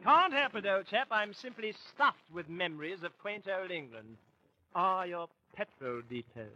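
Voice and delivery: put-on chummy, light English accent; an old chap's English accent; mock English accent. Danny answers Arthur, light mock English accent. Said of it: "What's wrong with my English accent?".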